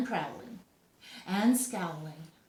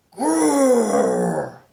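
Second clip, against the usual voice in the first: loud growl; bear growl; 4 semitones higher, 14 dB louder than usual. A narrator's reading. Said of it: "and it came out".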